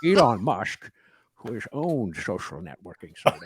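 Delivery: hammed-up Scottish Connery accent, slushy SH for S; Sean Connery accent. Host keeps reading the article as a joke, Sean Connery accent. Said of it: "maybe a Sean Connery accent you could go with".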